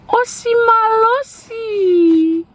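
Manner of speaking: high, slow singsong holler; playful drawn-out call. Pretend. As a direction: stretch the nickname out like a cheer. Calling out the new nickname, drawn out.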